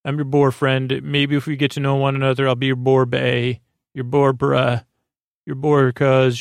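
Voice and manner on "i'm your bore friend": ordinary talk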